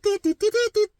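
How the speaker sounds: high sharp mock beeping; high chirpy phone beeps; imitated phone beeps. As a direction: beep it out like a dial tone. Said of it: "it's like".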